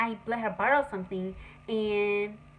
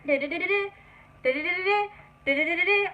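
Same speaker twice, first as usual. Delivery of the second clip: hummed phone ringtone, a little higher; imitated ringtone; 6 semitones higher, much faster than usual. Hearing his phone ring from the bathroom, hummed ringtone. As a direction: mimic the ringing phone in the bathroom. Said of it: "I kept hearing it".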